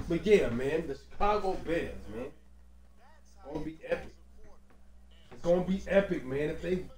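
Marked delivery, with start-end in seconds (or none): 2.3-4.1 slow, hushed whisper. Pretend. Vlogger whispering a prediction about the Bears, slow and hushed. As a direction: breathe it out like a secret.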